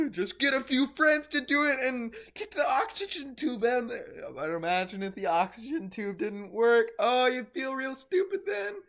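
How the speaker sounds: high, loud laughing speech; excited laughing chatter; laughing while talking. Real, not acted. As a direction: talk through the giggles.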